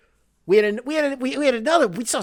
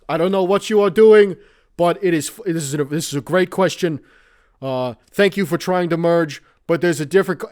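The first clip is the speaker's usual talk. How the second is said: louder, wordy senatorial ramble; Bernie Sanders impression; 2 semitones higher, 6 dB louder than usual. Bill Hader's Bernie as the blind spot detector, rambling. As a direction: ramble on like a politician. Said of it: "Bill Hader doing an impression of Bernie Sanders".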